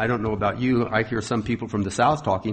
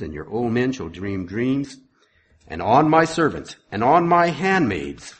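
raised, ringing sermon reading voice; scripture reading; 3 semitones higher, 4 dB louder than usual. Acted. Preacher reading Acts to his congregation, proclaiming.

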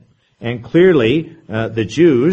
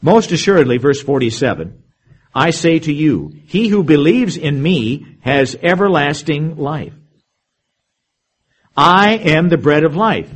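scripture reading; 3 semitones higher, 4 dB louder than usual. Acted. Reads verse forty-seven.

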